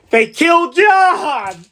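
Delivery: loud, slow, drawn-out cry of outrage; mock outrage. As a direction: wail it like a betrayed friend. Acted outrage at John's death.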